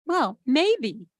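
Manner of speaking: usual speaking voice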